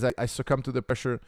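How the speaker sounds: ordinary talk, nothing marked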